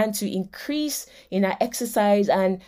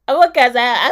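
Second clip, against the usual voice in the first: louder, mock-stubborn repeat of the husband; 7 dB louder, much faster than usual. Husband's line repeated after laugh.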